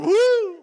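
very high, loud whoop; a big woo of delight; whooping cheer. Pretend. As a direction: let out a joyful whoop. Peter stepping out, mock celebration.

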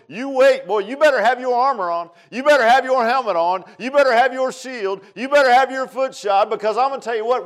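loud, high, insistent exhortation; full-force preaching. Real, not acted. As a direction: drive each warning home to the congregation.

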